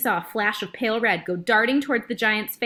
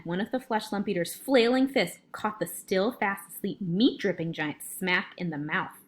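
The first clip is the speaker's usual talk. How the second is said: plain reading voice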